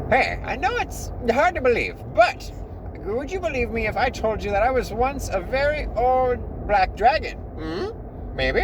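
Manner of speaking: high-pitched, pleading little demon; put-on high demon voice; cacodemon character voice. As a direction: squeak it out as an earnest small monster. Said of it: "let me get the voice".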